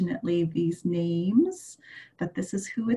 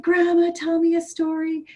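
wheedling little grandson; little chick voice; 7 semitones higher than usual. Acted. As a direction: beg like a small child at bedtime. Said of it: "and he said".

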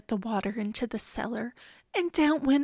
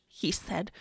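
ordinary delivery

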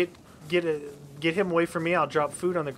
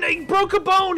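panicked; 8 dB louder than usual. Real, not acted. Repeats hurt news.

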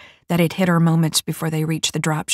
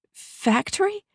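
much higher, wavering question; slower than usual. A narrator's reading. New mech puzzled by her origins, wavering. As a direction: ask it unsure, voice trembling. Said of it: "Vi's voice carried a waver".

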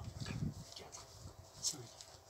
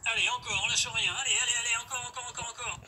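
loud, high hype shouting; gym bro; 6 semitones higher, 8 dB louder than usual. Acted. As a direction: push them hard through the final reps. Coach cheering on the last reps.

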